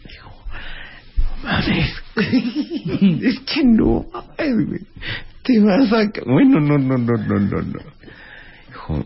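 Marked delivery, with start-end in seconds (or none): 1.2-7.8 high voice breaking into laughs; laughing disbelief. Retold or imitated. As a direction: squeal through the laughter.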